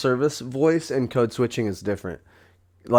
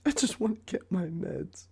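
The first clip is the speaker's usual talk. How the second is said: higher, softer mock whine; a whining parody of the line; 9 semitones higher, 8 dB quieter than usual. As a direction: echo it in a silly whiny voice. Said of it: "friend echoes it".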